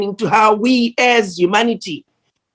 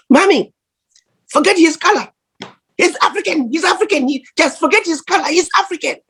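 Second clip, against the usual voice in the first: put-on high-pitched, comic French accent; Basil's French accent; 8 semitones higher than usual. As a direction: imitate him insisting in a funny French accent, pitched way up. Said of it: "He says in his funny ac-, French accent".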